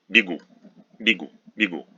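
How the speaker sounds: бегу said "bee-GOO"; weak "ee" in the first syllable of бегу; unstressed Е weakened. Shown as a demonstration. Teacher demonstrating the unstressed rule, бегу with weak "ee". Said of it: "is pronounced as weak E".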